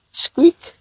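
tiny, very high mouse squeak; a sudden tiny squeak in the dark. Acted.

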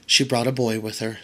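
usual speaking voice

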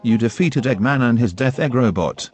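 ordinary delivery

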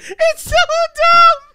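very high, loud, drawn-out wail; giddy disbelief. Real, not acted. Comic admitting his joke is dumb, gleeful loud wail. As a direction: wail it slowly in helpless delight. Helpless glee at own joke.

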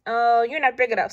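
loud dismissive scoff; grouchy ladybug. A narrator's reading. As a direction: scoff and fly off. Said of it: "said the grouchy ladybug".

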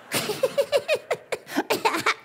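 pulsing staccato giggle; silly stuttering cackle; clownish laugh. Pretend. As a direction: laugh in rapid bursts at your own joke. Clown laughing at his joke.

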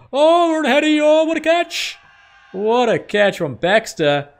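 loud, high cry of delight at the catch; excited shout. Real, not acted. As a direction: yell it out like a fan who just saw a screamer. Wicket caught in cricket game.

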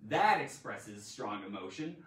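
ordinary delivery

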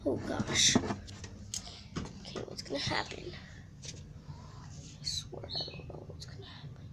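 tense whisper. Real, not acted. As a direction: hush it, nervous and tense. Tense moment in horror game.

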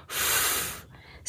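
फ as a voiceless rush of air, no vowel; air-only f. Shown as a demonstration. Teacher comparing फ to a balloon letting out air. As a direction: let air escape like a balloon on फ. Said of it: "सिर्फ हवा निकालनी है फ करते हुए".

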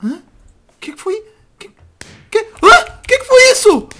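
high, loud startled panic; alarmed. Acted. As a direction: cry out in sudden alarm. Startled awake by noise, acted scene.

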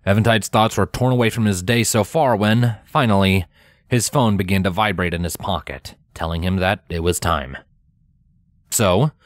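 plain reading voice

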